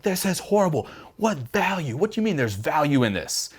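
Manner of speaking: high, strained mock outrage; exasperated disbelief. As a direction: whine like nothing could help. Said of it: "You're like".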